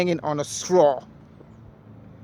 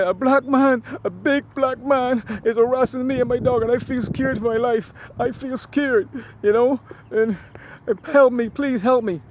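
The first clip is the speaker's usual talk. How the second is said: high, loud fake-tearful pleading; hammed-up sobbing plea for help, pitched up; imitating her crying to the cops, much higher and louder; mock crying; 8 semitones higher, 5 dB louder than usual. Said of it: "And she's crying".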